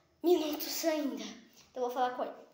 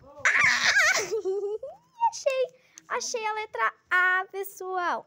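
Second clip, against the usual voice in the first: high, loud gleeful shriek; excited squeal; 7 semitones higher, 6 dB louder, slower than usual. Real, not acted. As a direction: shriek with delight at finding it. Finds a letter, squeals.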